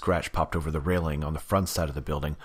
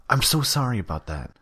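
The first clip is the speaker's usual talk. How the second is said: higher, overdone apology; fake relief; 7 semitones higher than usual. Damien faking relief to the driver on the phone. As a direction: lay the false relief on thick. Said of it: "pretending to be relieved".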